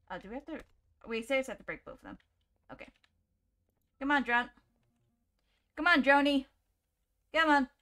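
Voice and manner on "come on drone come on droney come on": raised, coaxing call; urging call. Real, not acted. Player coaxing a stubborn drone, urging call. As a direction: call the drone along, lifting the pitch. Calling the game drone again.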